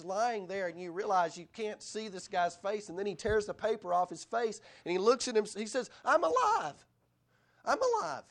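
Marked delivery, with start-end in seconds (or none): 6.1-8.2 high, loud overjoyed shout; joyful cry. Retold or imitated. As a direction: cry out like you just woke to new life. Man bursting from gift wrap.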